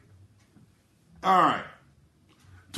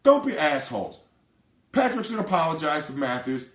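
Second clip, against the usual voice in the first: ordinary delivery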